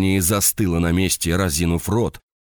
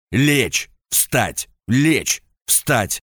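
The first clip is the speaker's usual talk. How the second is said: barked commands; 5 semitones higher, much slower than usual. Acted. Officer drilling a subordinate.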